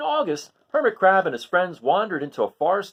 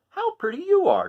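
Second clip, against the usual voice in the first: much higher, louder delighted voice; admiring crab; 6 dB louder than usual. Acted. Hermit Crab praises the coral, high and loud. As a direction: gush over the coral. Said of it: "said Hermit Crab".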